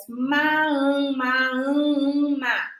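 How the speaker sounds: sounding out "ma, an", slow and clear; syllables swapped aloud. Shown as a demonstration. Teacher shows children how "ma" flips into "an". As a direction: play with the sounds "ma" and "an", one by one. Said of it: "mexer com os fonemas".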